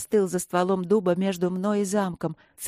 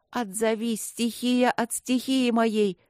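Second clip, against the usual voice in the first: raised, drawn-out summoning; spoken incantation; 4 semitones higher, slower than usual. A narrator's reading. Woman summoning fire amid gunfire, spoken incantation. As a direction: intone the spell, slowing at the end. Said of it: "под автоматные очереди проговорила".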